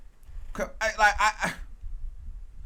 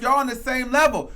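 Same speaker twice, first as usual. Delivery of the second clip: ordinary delivery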